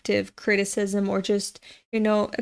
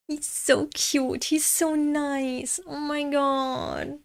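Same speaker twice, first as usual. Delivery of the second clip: higher, slow, soft gushing; gushing fangirl; 5 semitones higher, 5 dB quieter, slower than usual. Real, not acted. Reader gushes over the cute manga boy, slow and soft. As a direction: swoon over him, slowly and softly.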